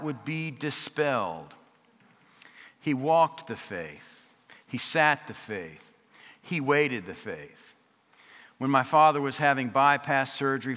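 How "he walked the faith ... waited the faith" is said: slow litany. Acted. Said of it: "repeated litany, slowed".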